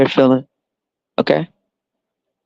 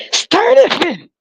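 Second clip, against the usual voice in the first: mock exclamation; slower than usual. Sarcastic mock command to breed.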